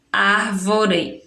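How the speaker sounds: "árvore" said slowly, syllable by syllable; teacher splitting the word; slow syllable separation. Shown as a demonstration. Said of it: "vamos separar a sílaba".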